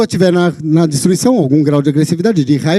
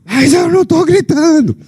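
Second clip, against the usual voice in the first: much higher, louder indignant cry; mock angry yell; 11 semitones higher, 3 dB louder than usual. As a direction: protest that you're not shouting, while shouting. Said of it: "Eu não vou gritar aqui". (grito indignado de brincadeira, bem mais agudo)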